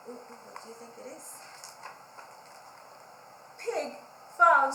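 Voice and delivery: put-on lively storybook read-aloud for kids; reading to children. A narrator's reading. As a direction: read it like library story time, bright and engaged. Reads picture book to children.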